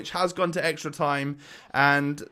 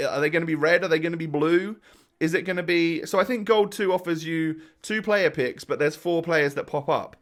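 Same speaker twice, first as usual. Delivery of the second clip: ordinary delivery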